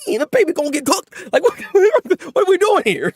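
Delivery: put-on freaking out about the baby; shocked disbelief.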